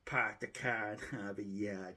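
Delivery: put-on dropped-r Boston accent, park the car as pahk the cah; lightly put-on Boston accent, r's dropped. YouTuber showing off his Boston accent to viewers, light Boston accent. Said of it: "Unless I use my Boston accent".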